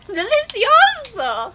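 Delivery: high, loud, very drawn-out "Delicioso"; over-the-top singsong exclamation; hammed-up echo. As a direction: stretch it out with theatrical relish. Hammed-up echo of co-host's word.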